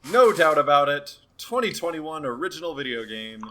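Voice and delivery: high-pitched, loud, over-the-top certainty; emphatic insistence. Real, not acted. Co-host vouching for a game's place on the list, emphatic insistence. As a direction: proclaim it with pitch pushed up.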